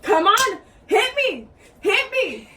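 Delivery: loud, high half-laughing dare; laughing mock taunt. Daring the other girl to hit, loud and laughing. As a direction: shout a dare while laughing. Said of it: "And I'm like".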